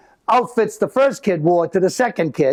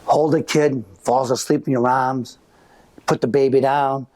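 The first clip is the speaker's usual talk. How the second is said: usual speaking voice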